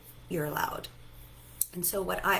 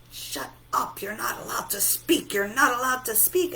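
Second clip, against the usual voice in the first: silencing outburst, climbing higher and louder; mock scolding; 5 semitones higher, 5 dB louder than usual. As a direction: shut the feeling down, building as you repeat. Acted scolding of the screaming feeling.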